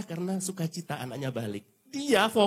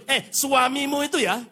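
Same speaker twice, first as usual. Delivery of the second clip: loud, higher, exasperated complaint; annoyed mother-in-law; 9 semitones higher, 6 dB louder, slower than usual. Retold or imitated. Mother-in-law annoyed at her son-in-law, exasperated. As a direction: grumble about the son-in-law. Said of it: "Lagi sebel".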